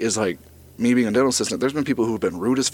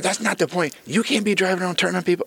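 high-pitched scolding; imitating a crabby woman telling someone off; 8 semitones higher than usual. Crabby homeowner scolding the driver, crabby. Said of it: "old crabby ass woman comes out".

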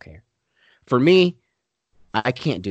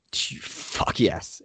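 whispered emphasis; 6 semitones lower, 6 dB quieter, much slower than usual. Real, not acted. Emphatic answer to his question.

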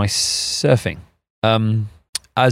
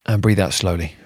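calm, paced breathing guide; guided breathing; 3 dB quieter than usual. Acted. Leads a breathing exercise.

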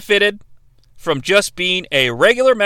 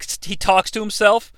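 ordinary delivery

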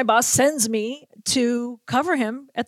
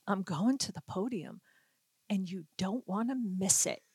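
much softer, low confidential tip; hushed secret; 3 semitones lower, 13 dB quieter than usual. Retold or imitated. Moss quietly tips off the reporter alone, hushed. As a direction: tip her off under your breath.

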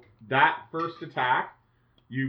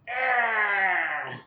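pained cry; 6 semitones higher than usual. Retold or imitated. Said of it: "they're all like".

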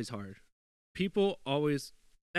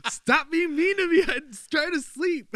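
much higher, louder, slow whine; mock whiny self; 10 dB louder, slower than usual. Imitating himself whining at his girlfriend to let him sleep. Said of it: "I was like".

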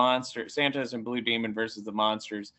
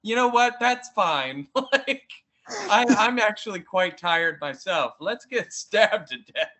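cheerfully resigned; 6 semitones higher than usual. Retold or imitated. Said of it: "he's like".

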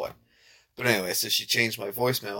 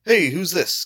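higher, louder casual query; pretend phone answer; 7 semitones higher, 5 dB louder, slower than usual. Shown as a demonstration. Showing the fake voicemail pickup, curious pretend answer. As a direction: ask as if taking a real call. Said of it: "like, the pretend answer".

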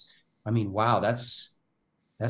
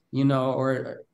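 ordinary talk, nothing marked

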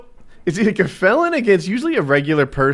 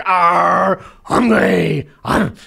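mock growling brute, higher, louder; caveman growl; 4 semitones higher, 4 dB louder, much slower than usual. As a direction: growl like a wordless caveman fighter. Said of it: "He's like".

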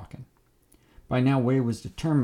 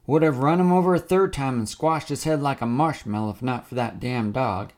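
loud gloating; 3 semitones higher, 6 dB louder than usual. Acted. Wade gloating threat.

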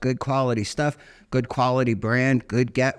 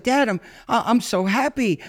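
higher voiced little boy, overwhelmed with joy; imitating his crying son; 10 semitones higher than usual. Dad retells his crying six-year-old son, higher childlike voice. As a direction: play the kid sobbing out that he's happy. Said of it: "He's like".

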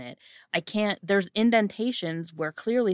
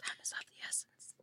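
hushed whisper, no voice; a barely audible whispered aside; 18 dB quieter than usual. Acted. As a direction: whisper it very softly. Whispered aside, then repeated aloud.

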